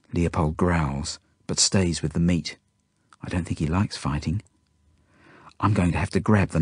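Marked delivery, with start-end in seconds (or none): none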